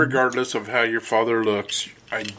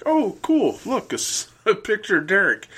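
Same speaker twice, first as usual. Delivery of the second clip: much higher, delighted "Oh, cool"; mock excitement; 8 semitones higher than usual. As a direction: play the cheerful surprise of finding a photo. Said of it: "and I was like".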